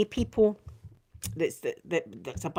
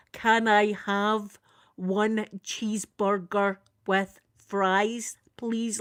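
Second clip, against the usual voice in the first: slow, word-by-word order; careful repeat; much slower than usual. Retold or imitated. Scottish customer repeating her order to puzzled Americans, slow and careful. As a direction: spell the order out slowly for someone who can't follow. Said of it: "I would have to repeat myself".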